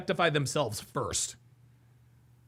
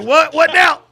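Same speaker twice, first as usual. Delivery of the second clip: loud, raised imitated challenge; mock taunt; 6 semitones higher, 11 dB louder than usual. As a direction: shout it like the gloating friend. Acting out friend's taunt.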